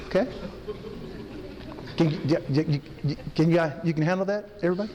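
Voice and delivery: normal talking voice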